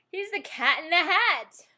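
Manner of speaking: loud, slow, booming cartoon cat; Cat in the Hat voice. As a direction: announce yourself as the Cat in the Hat.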